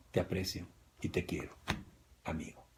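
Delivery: sober and restrained. Retold or imitated. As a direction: say it quietly, holding back. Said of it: "en su sano juicio". (sobrio y contenido, muy bajito)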